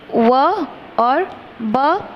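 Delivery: व और ब drawn out very slowly; slow demo. Shown as a demonstration. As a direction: spell out व और ब letter by letter. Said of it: "सब लगाकर". (व और ब बहुत धीरे-धीरे)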